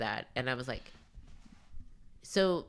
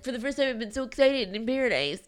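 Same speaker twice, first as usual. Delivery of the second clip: mock gushing Blake; 7 semitones higher than usual.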